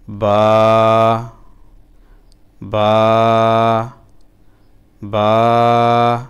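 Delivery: teacher drilling the letter aloud; model letter name. Shown as a demonstration. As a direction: pronounce বা distinctly for pupils to copy. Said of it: "উচ্চারণ করতে থাকবেন".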